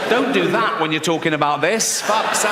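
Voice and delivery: usual speaking voice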